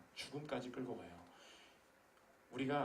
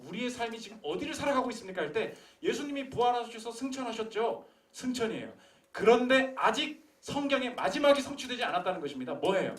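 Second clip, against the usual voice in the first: loud, high fervent preaching; preaching at full force; 10 semitones higher, 11 dB louder than usual. Acted. Pastor challenging the congregation, loud fervent preaching. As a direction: drive it home to the pews.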